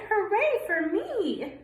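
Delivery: high, slow cheer for himself; duckling praises himself, high and drawn out; proud cheer. Acted. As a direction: crow it proudly. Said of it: "he cried".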